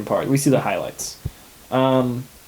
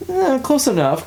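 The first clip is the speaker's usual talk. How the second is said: much higher, breezy dismissive shrug; shrugging close enough; 12 semitones higher than usual. Retold or imitated. Peter shrugs off the wrong name to Wanda, mock breezy. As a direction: toss it off like it doesn't matter. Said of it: "So he gives her, like".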